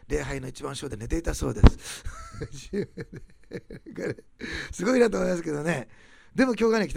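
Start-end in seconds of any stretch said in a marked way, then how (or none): 2.1-4.7 long soft laugh; real amused laugh. Real, not acted. Preacher amused by the youth sleeping through church, laughing. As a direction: laugh helplessly at your own story. Laughs at his own anecdote.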